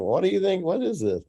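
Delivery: high-pitched imitation of asking; mock questioning. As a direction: quote yourself asking, pitched well up. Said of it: "me asking you a question".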